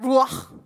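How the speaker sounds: Ruach said roo-AKH with a throaty final kh; Hebrew pronunciation. Shown as a demonstration. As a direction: model Ruach slowly, guttural kh at the end. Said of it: "You wanna say that?".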